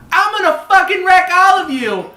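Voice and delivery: put-on small child's high, loud battle cry; shouted threat. Small child threatening everyone below, high shouted threat. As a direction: yell it high, down at a whole crowd.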